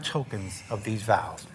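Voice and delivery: whispered aside to the couple; quietly cue the rings, almost no voice. Real, not acted. Pastor whispers the ring cue.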